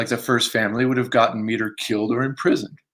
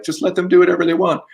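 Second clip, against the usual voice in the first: mock carefree; 7 semitones higher, 4 dB louder, faster than usual. Mocking the hands-off attitude.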